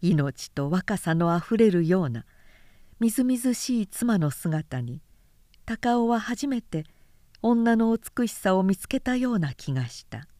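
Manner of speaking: usual speaking voice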